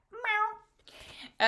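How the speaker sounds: high-pitched little kitty meow; small high mew; cat meow. Acted. Imitating her kitty cat.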